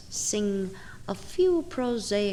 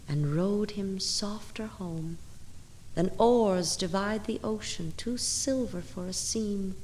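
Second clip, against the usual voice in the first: soft hush; 8 dB quieter than usual. Acted. Bird gliding softly away.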